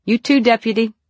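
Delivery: loud strained call back. A narrator's reading. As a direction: call up through the pain. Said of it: "she prayed and called back".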